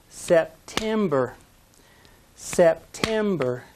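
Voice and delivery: September slow, middle syllable hit; sep-TEM-ber, drawn out slowly; stress on TEM. Shown as a demonstration. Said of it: "If you said the second one, you're right".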